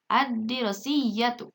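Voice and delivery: slow "Ad-dirosiyatu", lam merged into doubled D; silent lam. Shown as a demonstration. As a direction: read "Ad-dirosiyatu" deliberately with no L. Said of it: "lamnya jangan sampai terdengar jelas".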